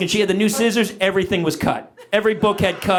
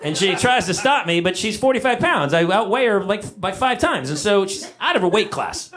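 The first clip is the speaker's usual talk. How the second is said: ordinary talk, nothing marked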